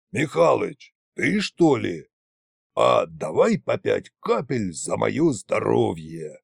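put-on slow drunk voice, sinking low; drunken tsar. A narrator's reading. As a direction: draw out a tipsy invitation to drink. Drunken tsar proposes a drink. (пьяным голосом, врастяжку)

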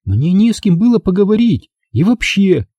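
put-on high, sulky girl's voice; pouting Angela. A narrator's reading. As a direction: pout it out. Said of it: "с надутым видом ответила Анжела". (Анжела обиженно, надув губы)

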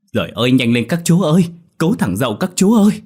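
high, loud frantic plea; urging shout. A narrator's reading. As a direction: hurry them along, begging. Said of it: "lại hối thúc".

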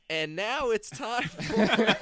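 slow, loud countdown announcer. Pretend. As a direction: build it up like a countdown host. Announcing the list.

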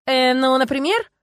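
high, hesitant fishing for words; improvising. A narrator's reading. Youth makes up a rule, stalling hesitantly. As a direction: stall while inventing something. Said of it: "словно пытаясь что-то придумать".

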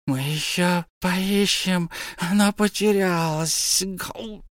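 very high, slow, lamenting creature; keen over the lost treasure; wailing Gollum. A narrator's reading. Gollum laments his lost precious, high and slow.

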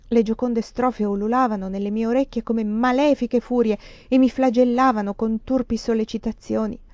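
ordinary delivery

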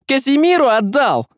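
high shouted outburst; furious outcry; crying out. A narrator's reading. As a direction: shout in disbelief. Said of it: "выкрикнул он".

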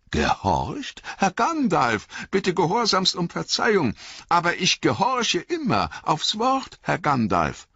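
high, protesting innocence; Sam, flustered servant. Acted. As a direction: plead pardon in a high, over-obedient servant's voice.

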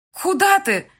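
high, loud, startled call; calling after him. A narrator's reading. Bride calling after her groom as he leaves, alarmed. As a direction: call out sharply, pitch right up. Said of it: "окликнула я его".

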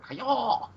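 high-pitched mock kiai; drawn-out martial-arts cry; karate cry. Imitating Hichikata kicking down the stall door, karate cry. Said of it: "Does a karate kick".

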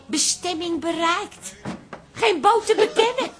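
mock sat-nav voice, turning into a loud cry; tomtom voice. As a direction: imitate the sat-nav announcing, then burst out. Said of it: "zegt die tomtom".

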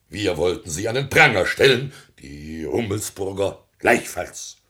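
ordinary delivery

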